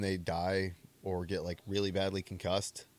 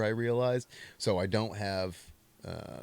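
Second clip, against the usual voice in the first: normal talking voice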